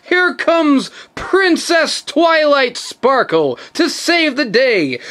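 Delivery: ordinary talk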